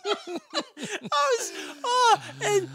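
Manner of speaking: high cracking laughter through words; laughing. Real, not acted. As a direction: laugh while trying to talk. Laughing at own story.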